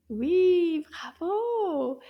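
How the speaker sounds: high, loud opening cheer; delighted praise. Real, not acted. Teacher praises class for finding the sound, delighted. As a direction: cheer the right answer with stretched vowels.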